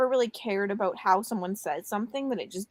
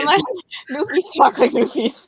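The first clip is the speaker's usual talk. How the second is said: laughing; 6 semitones higher, 7 dB louder than usual. Real, not acted. Laughing about Newfie accents.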